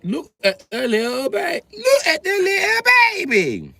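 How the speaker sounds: put-on very high, slow little girl voice; little British girl. A five-year-old girl cooing at a baby, high and slow. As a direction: gush like a tiny girl over a baby. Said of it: "five-year-old British girl".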